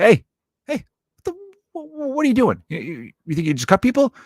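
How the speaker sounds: high-pitched, stammering protest; acted outrage, tripping over the words; sputtering indignation. Man in hot dog line confronting a cutter, indignant.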